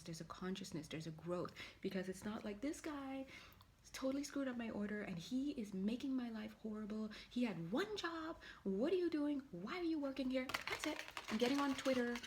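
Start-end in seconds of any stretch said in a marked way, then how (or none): 2.6-12.1 high, indignant mock outrage at the clerk; hammed-up complaint, pitched way up; mock rant. Mock rant at ice cream clerk.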